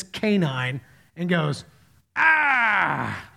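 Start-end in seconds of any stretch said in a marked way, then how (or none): 2.2-3.3 higher mock baby shout, drawn out; imitated baby yell. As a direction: imitate a baby hollering at a dog. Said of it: "I get ready to yell at the dog again".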